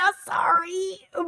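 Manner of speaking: high, stretched-out sorry; high re-enacted sorry; squeaky apology. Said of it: "I was like".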